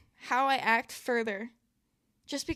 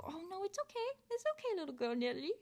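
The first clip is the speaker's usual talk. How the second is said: soft, tender, hushed reassurance; soothing my inner child; 7 semitones higher, 9 dB quieter, faster than usual. Retold or imitated. Talking to her inner child, soft and tender. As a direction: comfort the little girl inside, very softly. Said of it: "Whenever I go".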